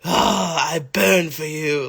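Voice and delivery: slow, mock-passionate period-drama cry; drawn-out mock swoon; repressed period-drama lover. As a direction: ham up a costume-drama declaration of love. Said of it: "repressed, and then they find".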